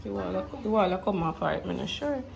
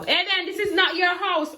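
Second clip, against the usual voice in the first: scolding shout; 10 semitones higher than usual. Real, not acted. Scolding child running into house.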